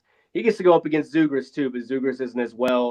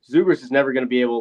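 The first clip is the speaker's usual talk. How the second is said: ordinary delivery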